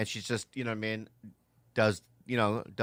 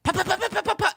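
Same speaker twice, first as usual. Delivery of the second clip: very high rapid-fire "pa" noises; angry little mouse. Shown as a demonstration. Demonstrating his old speed, angry little mouse noise. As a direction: squeak out a tiny frantic piston. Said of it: "like angry little mouse".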